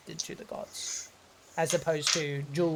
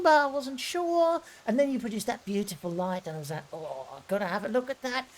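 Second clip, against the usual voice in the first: put-on higher, gushing older woman; chatty old lady; 7 semitones higher than usual. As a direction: flatter them sweetly, a touch theatrical. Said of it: "and she's like".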